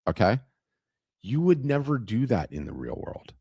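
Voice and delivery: ordinary talk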